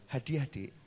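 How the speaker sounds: soft, low offering; gentle husband. Acted. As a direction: offer the gift kindly. Husband offers gift softly.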